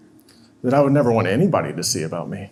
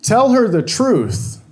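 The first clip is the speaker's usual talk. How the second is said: slow, higher, insistent inner voice; 9 semitones higher, 4 dB louder, much slower than usual. Retold or imitated. Conscience urging him during the lie, slow and insistent. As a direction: let the conscience urge him, drawn out and pleading. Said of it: "another voice inside of me that's saying".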